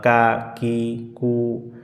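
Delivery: কা কি কু said lightly and softly; light front ক syllables, said softly. Shown as a demonstration. Teacher showing light কাফ syllables. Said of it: "এটি আমরা যেমন সাধারণত".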